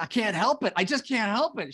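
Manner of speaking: mock helpless protest. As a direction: plead that you just can't help it, half joking. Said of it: "I said".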